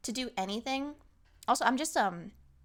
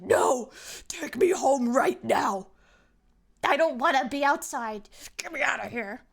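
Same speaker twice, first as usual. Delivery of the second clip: grumpy demand; 3 semitones higher, 7 dB louder than usual. Retold or imitated. Her own grumpy cold self.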